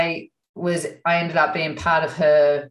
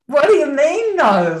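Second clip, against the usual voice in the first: much higher, louder startled protest; indignant surprise; 9 semitones higher, 5 dB louder than usual. Retold or imitated. Asking the psychic why she refused, startled. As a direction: protest like you've been turned away.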